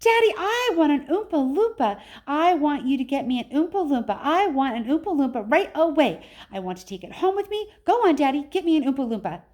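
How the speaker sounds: put-on high, loud whiny spoilt-child voice; spoilt girl demanding. Spoilt Veruca demands from father.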